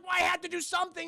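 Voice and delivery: high, fast protest; imitating a rattled man defending himself; retold defensive protest. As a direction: snap back in mock panic. Retold quarrel, panicked defence.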